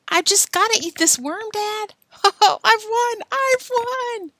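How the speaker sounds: put-on high, loud triumphant boy; gleeful boy. Billy gloating to his dad, high and loud. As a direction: gloat with a laugh.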